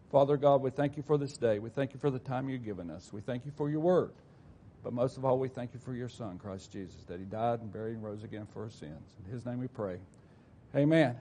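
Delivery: a gentle pastor's prayer fading low. Acted. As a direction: pray it quietly, reverently. Leads an opening prayer.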